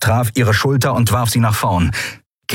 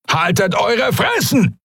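high, slow, furious yell; angry shout; 13 semitones higher than usual. A narrator's reading. Armed pirate silencing his captives, angry shout. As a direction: yell at prisoners to shut up.

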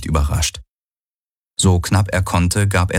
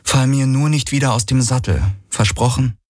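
higher, half-joking plea; worried young woman; 7 semitones higher than usual. A narrator's reading. Kirai asks the Aur not to drop her, higher and worried. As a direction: ask it in a raised, anxious voice.

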